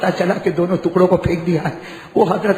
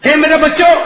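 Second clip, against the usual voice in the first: high, louder grieving call; loud lament; 7 semitones higher, 6 dB louder than usual. Acted. Father calling his dead children, high loud lament. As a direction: cry out to the dead sons. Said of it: "repeated address".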